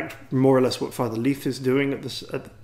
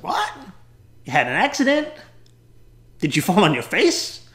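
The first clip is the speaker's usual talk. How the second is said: put-on high, loud, incredulous child; little American girl; 8 semitones higher, 5 dB louder than usual. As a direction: blurt questions like a puzzled little girl. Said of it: "little American girl".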